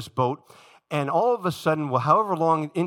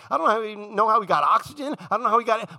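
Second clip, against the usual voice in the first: ordinary delivery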